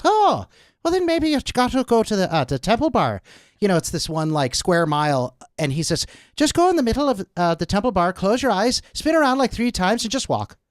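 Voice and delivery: a retold Irish concierge, high-pitched Irish lilt; Irish accent. Irish concierge giving a tourist directions, in an Irish accent. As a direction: put on an Irish brogue as the Dublin concierge. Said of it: "That's a good accent".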